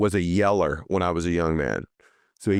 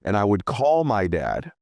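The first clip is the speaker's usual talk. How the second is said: ordinary talk, nothing marked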